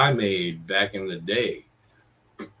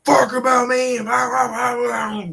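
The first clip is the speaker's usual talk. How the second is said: much higher, whiny mock voice trailing into blah blah; mocking her whine; 13 semitones higher, 5 dB louder than usual. Mocking the roommate's endless complaint, high whiny voice. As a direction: sneer it in a high nagging whine. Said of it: "like, growling and go".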